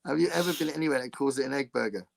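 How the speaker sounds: ordinary delivery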